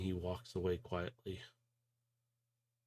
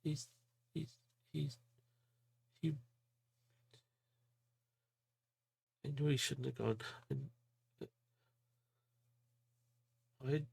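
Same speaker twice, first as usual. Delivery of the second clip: high, soft and broken, halting; a small voice close to tears; tearful girl; 5 semitones higher, 6 dB quieter, faster than usual. Acted. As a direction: grief catching in her throat. Addie grieving her lost friend.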